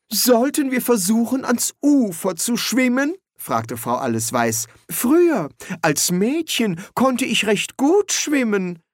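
a prim housekeeper excusing herself; housekeeper's voice. Acted. Housekeeper interrupting the quarrelling wizards, high and polite.

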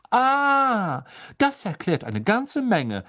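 sharp high Ah, then slow and dry; sour. A narrator's reading. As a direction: acid sarcasm. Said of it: "sagte Professor McGonagall säuerlich". (säuerlich, sarkastisch)

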